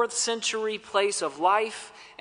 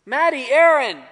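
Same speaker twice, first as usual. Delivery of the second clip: louder, higher name call; 6 semitones higher, 7 dB louder than usual. Retold or imitated. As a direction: shout the names into fog. Roll call in fog.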